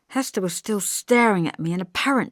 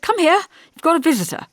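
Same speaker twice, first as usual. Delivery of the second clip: high call to another room; 11 semitones higher, 5 dB louder, faster than usual. A narrator's reading. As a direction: call her from the next room. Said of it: "called David".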